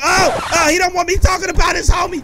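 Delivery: high, loud, fast trash talk; taunting shout. Real, not acted. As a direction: goad the enemies into a fight. Taunting the game's enemies.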